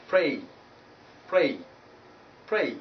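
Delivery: "pray" with a gliding ay; diphthong ay. Shown as a demonstration. Teacher modelling the example word, diphthong ay. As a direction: end "pray" gliding towards i. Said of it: "not pray".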